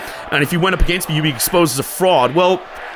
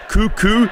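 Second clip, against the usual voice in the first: crowing cuckoo, pitched up; a light mock rooster crow; rooster impression; 3 semitones higher than usual. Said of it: "doing rooster impressions".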